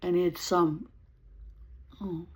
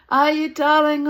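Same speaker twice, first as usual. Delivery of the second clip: mocking darling; 10 semitones higher, 14 dB louder than usual. Mocking flirt who threatened her.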